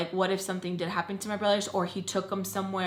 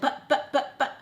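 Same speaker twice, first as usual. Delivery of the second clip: higher stammering of her own protest; mock stutter; 6 semitones higher than usual. Mimicking herself stuttering at grandma, higher pitched. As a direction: trip over the words on purpose. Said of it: "I was, like, stuttering".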